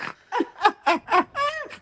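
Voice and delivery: very high villain cackle; evil doctor laugh. Retold or imitated. Auditioning as villain from a car in traffic, evil cackle. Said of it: "evil doctor, like, evil person".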